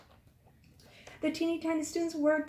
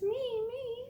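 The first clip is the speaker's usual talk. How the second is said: put-on tiny child voices; 11 semitones higher than usual. Tiny students begging to go to the park, high and eager. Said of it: "in their teeny tiny voices".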